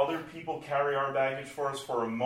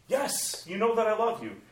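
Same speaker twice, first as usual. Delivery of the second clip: Peter's eager answer, much higher; pleading Peter; 10 semitones higher, 4 dB louder than usual. Acted. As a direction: insist eagerly you love him.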